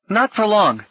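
high-pitched urgent lad; high boyish voice; boy Gally, insistent. A narrator's reading. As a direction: warn as a hurried boy.

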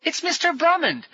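put-on high boyish excitement; thrilled young boy's voice; delighted. A narrator's reading. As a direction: cry out in delight.

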